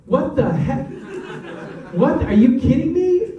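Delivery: mock-indignant squeal of disbelief; stunned disbelief. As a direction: replay the shock of seeing your scene cut. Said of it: "I was like this".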